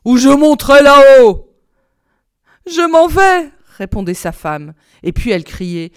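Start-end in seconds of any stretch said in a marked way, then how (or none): 0.0-1.5 booming threat; roaring tyrant husband; angry shouting. A narrator's reading. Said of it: "criait la Barbe-Bleue". 2.6-3.5 high, loud anxious reply; flustered wife, pitch raised; frightened answering. A narrator's reading. Frightened wife answers shouting husband.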